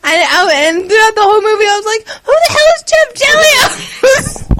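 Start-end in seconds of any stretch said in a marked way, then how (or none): 2.2-4.6 confused shout. Retold or imitated. Confused shout during the movie.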